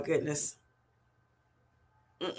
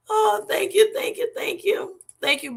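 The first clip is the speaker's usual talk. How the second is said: much higher, squealing thank-yous; overjoyed squeal; 14 semitones higher than usual. Real, not acted. Streamer squeals her thanks to Bellflower for the super chat, overjoyed. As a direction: gush out the thanks in delight.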